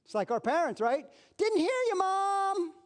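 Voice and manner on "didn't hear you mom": put-on high, innocent child's voice, much higher; mock innocent kid. Child fibbing to his mom, high mock-innocent voice. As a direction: play the kid pretending not to have heard.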